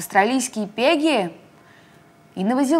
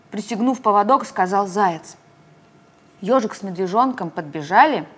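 usual speaking voice

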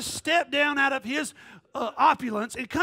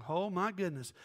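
ordinary delivery

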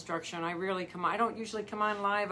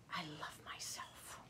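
whispered self-love; 4 semitones lower, 17 dB quieter than usual. Shown as a demonstration. Coach showing singers how to speak to themselves, whispered. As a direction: breathe it like a private promise to yourself.

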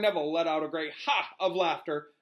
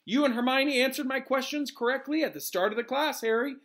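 light, raised teacher's voice; easy, higher-pitched reply; said lightly; 9 semitones higher, 3 dB louder than usual. A narrator's reading. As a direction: toss it off lightly to a pupil. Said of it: "said Lupin lightly".

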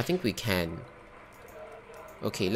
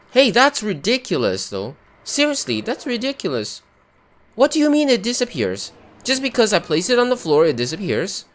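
exasperated rant; 9 semitones higher, 5 dB louder than usual. Real, not acted. Ranting at the bug.

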